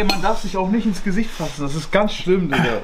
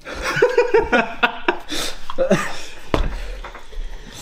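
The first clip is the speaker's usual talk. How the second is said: breathy laugh; 8 semitones higher than usual. Real, not acted. Laughs through the burning spice.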